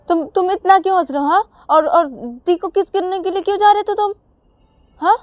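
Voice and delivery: high, loud, fast interrogation; suspicious questioning. Acted. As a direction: grill him accusingly. Ravi questions Rahul.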